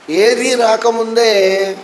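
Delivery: normal talking voice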